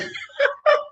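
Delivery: high, breaking laugh; helpless high giggle. Real, not acted. Dancer laughs over the demon glowing while dying, high laugh. Laughs at her own story.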